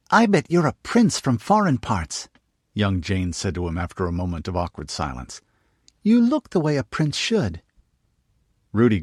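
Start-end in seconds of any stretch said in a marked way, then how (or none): 0.1-2.3 put-on high, eager little girl; young girl voice. Narrator voices young girl Jane. 6.0-7.6 put-on high, eager little girl; young girl voice. Narrator voices young girl Jane.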